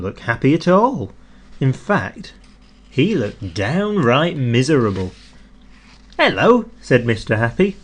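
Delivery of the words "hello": cheery greeting. A narrator's reading. Mister Happy greets cheerfully.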